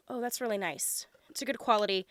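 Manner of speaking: ordinary talk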